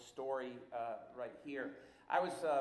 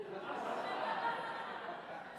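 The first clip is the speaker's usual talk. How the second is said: laughing; 3 dB louder than usual. Real, not acted. Laughs at wife's insistence.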